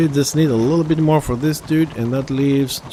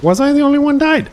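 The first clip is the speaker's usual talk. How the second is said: outraged surprise; 12 semitones higher, 5 dB louder, faster than usual. Real, not acted. Indignant at dying in game.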